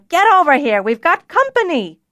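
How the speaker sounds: high, loud bellowed call. A narrator's reading. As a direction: shout it to the back room. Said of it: "She raised her voice to a bellow".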